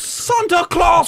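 high drawn-out shout. Pretend. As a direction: belt out the name. Shouting the name.

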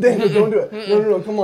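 high, loud urgent pleading. Real, not acted. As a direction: beg him not to quit. Urging friend not to quit.

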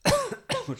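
coughing, struggling for air after swallowing wrong; choking on a drink. Real, not acted. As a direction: speak through a coughing fit. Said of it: "hab ich gerade verschluckt". (verschluckt, hustend und nach Luft ringend)

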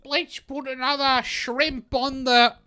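high, hammed-up Australian voice; mock Australian accent. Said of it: "pretend to be Australians".

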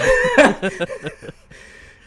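loud, high cackling laugh; high-pitched belly laugh; burst of laughter. Real, not acted. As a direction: crack up loudly at a friend's slip. Cracking up at waiver chip.

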